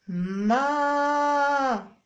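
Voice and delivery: long held ما; drawn-out syllable ma. Shown as a demonstration. Mother showing the sound with a hand gesture, held long. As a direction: hold ما out while raising a hand. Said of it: "ترفع يدك".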